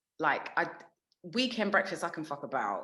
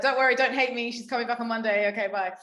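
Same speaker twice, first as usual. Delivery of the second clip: higher and louder, a hammed-up apologetic plea; apologetic reassurance; 5 semitones higher, 3 dB louder, faster than usual. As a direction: act out begging the listeners not to hate you, then a quick goodbye.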